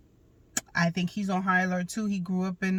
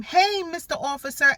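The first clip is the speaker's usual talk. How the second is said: put-on much higher, bright child greeting; cheerful little boy; 8 semitones higher, 4 dB louder than usual. Mom imitates her son happily greeting the cop, high and childlike. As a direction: chirp it like an eager little kid.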